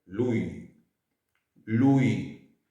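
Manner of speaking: "lui" said as Italian loo-ee, slow and clear. Shown as a demonstration. Teacher modelling Italian "lui" for students, clear and deliberate. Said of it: "En italiano es".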